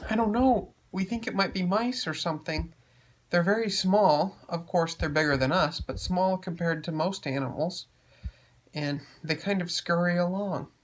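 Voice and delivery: high, earnest little creature; high character voice. Acted. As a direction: explain it in a high voice. Ray voiced in story.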